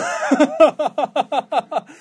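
high, loud burst of laughter; long real belly laugh, falling away. Real, not acted. (크게 터진 웃음)

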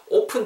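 "open" with a tense, unaspirated Korean ㅃ sound for the p; tense double-bieup p. Shown as a demonstration. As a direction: say "open" like "오쁜", tight p without a puff. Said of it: "약간 쌍비읍 발음으로".

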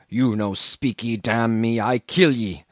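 slow threatening broken English; menacing Queequeg. A narrator's reading. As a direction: threaten him, slow and heavy. Cannibal threatens with tomahawk.

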